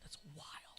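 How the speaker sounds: very soft whispered reaction; whispered aside. Retold or imitated. Cousin reacting at a candlelight vigil, whispered. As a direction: whisper it so only your cousin hears.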